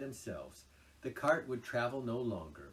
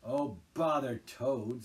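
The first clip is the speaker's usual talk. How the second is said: louder, slow savage dismissal; 4 semitones higher, 9 dB louder, slower than usual. A narrator's reading. Rat savagely dismisses Toad to Mole. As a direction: spit it out in disgust. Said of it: "said the rat savagely".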